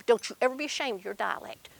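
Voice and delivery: ordinary delivery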